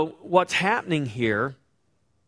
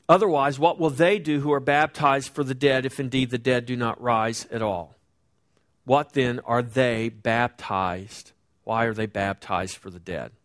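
scripture reading; 3 semitones lower than usual. Acted. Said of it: "reads scripture verse".

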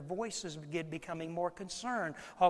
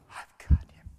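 whispered reassurance; 12 dB louder than usual. Retold or imitated. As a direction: breathe it out gently to the panicked husband. Wife reassures frantic husband.

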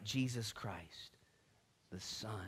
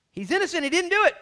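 high, loud outburst of protest; indignant protest; 16 dB louder, faster than usual. Acted. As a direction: protest it loudly, pitched way up. Acted protest for the innocent man.

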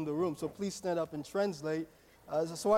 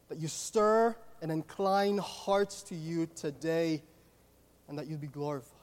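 public prayer; 3 dB louder than usual. Real, not acted. Leads the congregation in prayer.

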